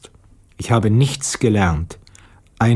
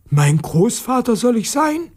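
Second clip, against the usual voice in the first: put-on high boy voice in disbelief; a child who can't believe it; indignant boy; 11 semitones higher than usual. Petja protests to Aunt Natascha, high disbelieving boy voice.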